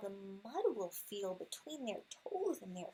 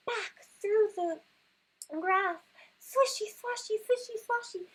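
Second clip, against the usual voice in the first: much higher and louder, breathless dash; panicked rush; 10 semitones higher, 8 dB louder than usual. Acted. Reading the frantic escape to her son, high and breathless. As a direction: race through it in mock panic. Racing back home from bear.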